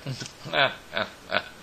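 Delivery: high-pitched gleeful cackle; storybook witch's laugh. A narrator's reading. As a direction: cackle high in wicked glee. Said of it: "She laughed".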